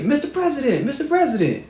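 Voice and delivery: calling out high to the President; retold yelling. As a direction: reach over the crowd with a high call. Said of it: "I'm yelling".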